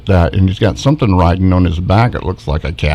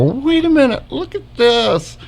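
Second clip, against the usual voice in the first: much higher pitched, startled call of discovery; sudden high surprise, slowing on look at this; surprised exclaim. Retold or imitated. Retelling spotting something in the pine tree, surprised high exclaim. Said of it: "and I go".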